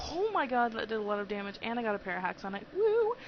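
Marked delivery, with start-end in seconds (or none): 2.7-3.1 high loud woo; excited cheer. Real, not acted. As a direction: whoop it out. Cheering a hit.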